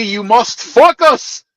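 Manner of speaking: slow high mock Greek accent; heavy Greek accent. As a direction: needle her with the accent. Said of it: "that heavy ass accent".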